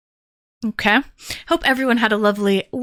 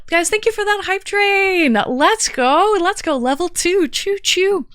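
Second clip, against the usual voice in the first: excited; 9 semitones higher than usual. Acted. Hype train cheer, high.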